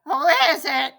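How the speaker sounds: loud, lightly voiced wolf posing as granny; wolf faking a sweet granny, loudly; sweet granny disguise. A narrator's reading. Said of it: "in his sweetest granny voice".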